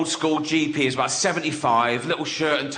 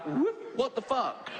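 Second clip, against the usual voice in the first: mock surprised shriek; 9 semitones higher, 8 dB quieter, slower than usual. Girlfriend returns to dead baby.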